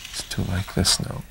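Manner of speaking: soft unvoiced whisper. Real, not acted. Therapist whispers to child.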